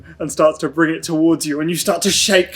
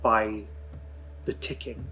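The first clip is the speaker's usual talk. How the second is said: dreamy scene narration; 9 dB quieter than usual. Acted. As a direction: let the words float like drifting in nothing. GM narrates Mason's drifting dream.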